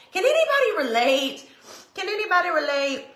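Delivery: high, laughing eager ask; excited. Real, not acted. To her live viewers, an eager high question. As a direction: pull the viewers in.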